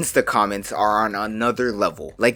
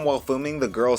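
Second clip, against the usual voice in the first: ordinary delivery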